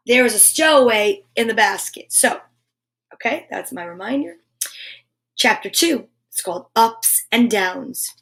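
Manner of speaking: ordinary delivery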